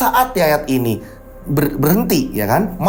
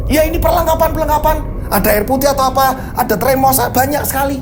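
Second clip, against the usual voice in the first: high-pitched, exasperated listing; defensive explaining; 6 semitones higher than usual. Acted. Driver explaining his supplies to the guard, defensive exasperation. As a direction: list it all defensively, voice pitched up.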